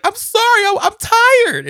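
very high, loud mock wail; hammed-up tearful apology; imitated crying. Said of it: "And she's crying".

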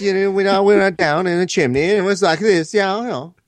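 high, rambling fighter impression; mock fighter. Imitating a fighter's interview.

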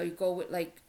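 ordinary talk

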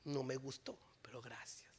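soft wry whisper; hushed ironic thanks; whispered aside. Real, not acted. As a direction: breathe the thanks as a joke.

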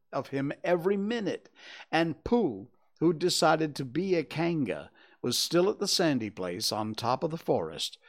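ordinary delivery, nothing marked

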